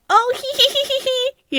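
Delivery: high, breathy giggling; imitating son's nervous high giggle. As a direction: giggle up high like a spooked kid.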